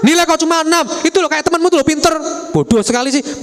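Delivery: high, scornful scolding; scornful mother. Acted. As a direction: belittle the child's grade. (ibu merendahkan anak, nada tinggi)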